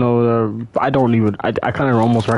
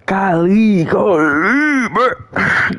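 excited; 5 semitones higher, much slower than usual. Real, not acted. Surprised by enemies popping out.

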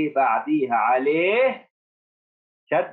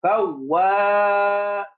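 فواز held long and higher; drawn-out dictation; 7 semitones higher, 4 dB louder, much slower than usual. Shown as a demonstration. Teacher dictating فواز to the class, stretched out. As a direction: call out فواز slowly for dictation. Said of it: "dictation word called out".

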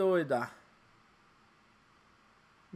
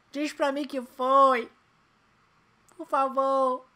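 high, slow pleading whine; 8 semitones higher, 9 dB louder, much slower than usual. Real, not acted. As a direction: beg the screen. Begging the result.

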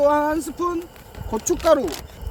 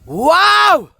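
excited; 3 semitones higher, 7 dB louder, much slower than usual. Real, not acted. Excited at leftover meat.

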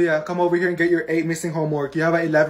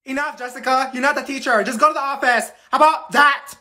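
stern scolding; 6 semitones higher, 3 dB louder than usual. Acted. Teacher scolding the student.

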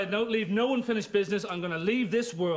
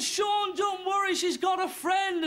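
much higher, shouted reassurance; excited shout; 10 semitones higher, slower than usual. Retold or imitated. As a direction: yell it up high like a hyped friend. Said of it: "he said to me, he said".

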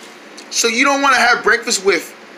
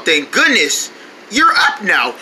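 ordinary delivery

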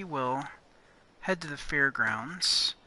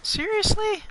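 incredulous; 6 dB louder than usual. Real, not acted. Reacting in disbelief to the game.

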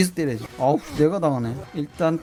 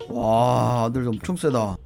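ordinary delivery

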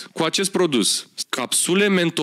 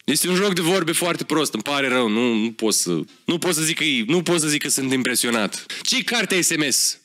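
normal talking voice

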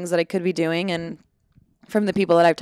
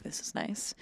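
whispered aside; 3 semitones lower, 14 dB quieter than usual. Retold or imitated. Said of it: "And I'm like".

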